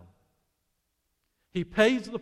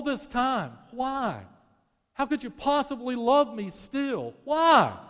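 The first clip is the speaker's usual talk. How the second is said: pleading; 6 semitones higher than usual. Acted. Wife's pleading questions, preached.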